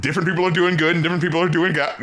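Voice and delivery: normal talking voice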